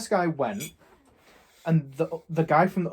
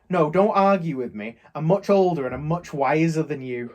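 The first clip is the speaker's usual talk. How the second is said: mock indignant; 2 semitones higher, 7 dB louder than usual. Retold quarrel with shop customer.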